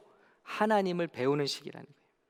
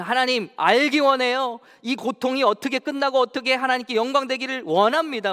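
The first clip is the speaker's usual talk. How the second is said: pleading prayer; 7 semitones higher, 5 dB louder than usual. Acted. 성도의 기도를 재연.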